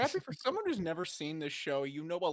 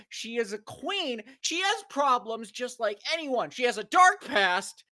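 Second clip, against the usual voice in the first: indignant rant; 8 semitones higher, 8 dB louder than usual. Retold or imitated. Reading author's defensive note aloud.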